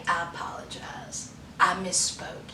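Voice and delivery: low, hushed imitated apology; meek apology. Host voicing the apology wanted from Joe, hushed and low. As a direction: mumble a contrite admission very softly.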